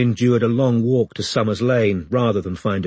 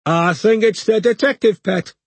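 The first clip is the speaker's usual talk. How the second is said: high Geordie voice; Geordie accent; 11 semitones higher, 4 dB louder than usual. Acted. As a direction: speak with a Geordie lilt. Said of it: "said a Geordie voice".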